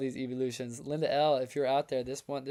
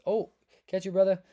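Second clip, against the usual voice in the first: called out loud and high to a viewer; hearty shout-out; 5 semitones higher, 8 dB louder than usual. Real, not acted. Greeting a chat viewer.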